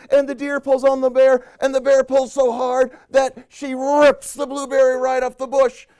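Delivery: high, loud, driving narration; excited climax. A narrator's reading. Chain of animals jolts, excited high narration.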